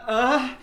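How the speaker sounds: high, loud feeble grunt; a last comic gasp before dying; dying old count's last croak; dying groan. Retold or imitated. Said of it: "Count Cyril goes".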